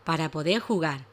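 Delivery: story narration. Acted. Reads a story to children.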